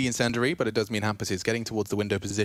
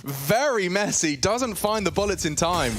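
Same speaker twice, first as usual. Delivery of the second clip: esports caster; 7 semitones higher than usual. Real, not acted. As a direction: shout the action as it happens. Live play-by-play spike.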